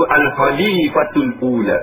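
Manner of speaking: Al-Khalifatul Ula slow, the long l held; teacher modelling Al-Khalifatul Ula; careful Arabic recitation. Shown as a demonstration. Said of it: "Lam tu panjang".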